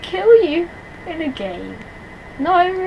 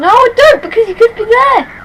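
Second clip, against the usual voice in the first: high, loud panicked protest; panicky; 10 semitones higher, 8 dB louder than usual. Real, not acted. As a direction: refuse in a rising panic. Refusing to look, scared.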